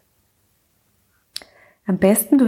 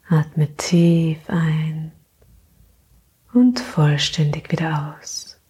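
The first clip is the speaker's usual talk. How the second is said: sleep meditation; 2 semitones lower than usual. Acted. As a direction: gently lull her into sleep.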